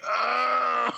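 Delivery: mock pained cry. Bruce shot, cries out.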